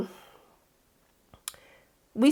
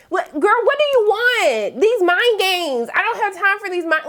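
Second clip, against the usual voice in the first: mock exasperation; 8 semitones higher than usual. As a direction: whine it at her as if fed up. Exasperated with her mind games.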